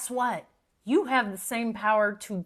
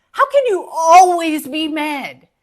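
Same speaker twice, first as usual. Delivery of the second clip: louder, pressing exasperation; exasperated loud repeat; 4 semitones higher, 9 dB louder than usual. Acted. Scolding friends who are always mad, loud exasperated repeat. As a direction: demand it again, fed up.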